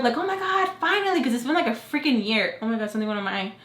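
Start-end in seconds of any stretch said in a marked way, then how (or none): 0.2-1.2 retold squeal of "at last"; pumped-up. Dental staff pumping up their patient, excited. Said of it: "they get me all pumped up".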